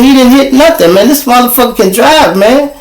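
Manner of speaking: high-pitched, worked-up officer; acted-out excited testimony; retold excited protest.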